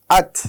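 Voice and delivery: আত with a puff of air released after the ত; aspirated t. Shown as a demonstration. Teacher showing the breathy ত to students. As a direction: breathe out on the final ত of আত. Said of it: "একটু বাতাস ছাড়তে হবে".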